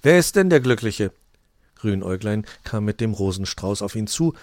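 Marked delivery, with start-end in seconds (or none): 0.0-1.1 higher, louder curious ask; eager question. Acted. Saint Nicholas asking who the lucky man is, eager.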